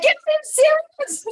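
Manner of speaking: high, loud mock panic with a laugh; a hammed-up high squeal of alarm; mock panicked shriek. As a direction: shriek it like you're locked out. Panicked at locked balcony door.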